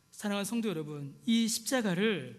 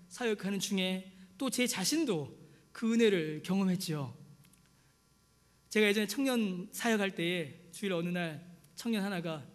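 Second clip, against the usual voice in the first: normal talking voice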